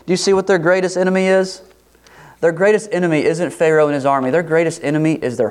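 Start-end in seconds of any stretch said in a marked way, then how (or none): none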